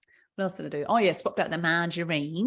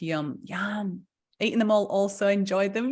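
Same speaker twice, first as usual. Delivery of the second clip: ordinary talk, nothing marked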